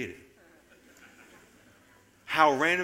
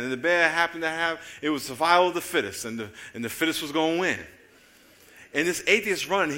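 normal talking voice

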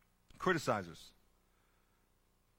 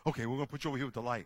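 normal talking voice

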